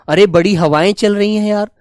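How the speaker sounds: ordinary talk, nothing marked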